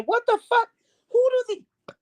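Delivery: high, crying cry of outrage; crying outburst. Real, not acted. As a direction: voice breaks into a wail of disbelief.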